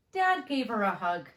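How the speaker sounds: ordinary delivery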